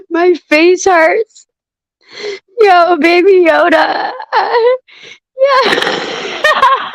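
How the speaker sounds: high, slow laughter through words; laughing hard. Real, not acted. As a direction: barely get words out for laughing. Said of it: "I'm laughing so hard".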